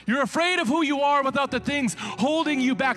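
high, loud preaching at full force. Acted. Preacher urging the congregation, full force. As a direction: drive it home from the pulpit.

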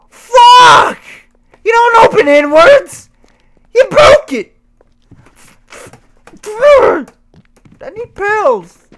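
high, loud slow rage shouts; shaking with anger. Real, not acted. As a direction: scream in frantic fury. Rage rant at game.

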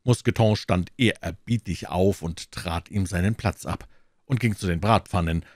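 ordinary delivery, nothing marked

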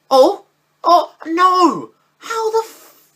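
sudden high yelp of disbelief; high, loud shocked cry; shocked outburst. Real, not acted. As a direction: cry out as the goal goes wrong. Reacting to conceded chance.

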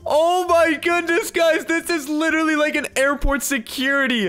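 high-pitched astonished exclamation; amazed outburst. Real, not acted. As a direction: blurt it out in amazement. Sees the scanner revealed.

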